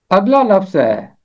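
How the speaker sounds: normal talking voice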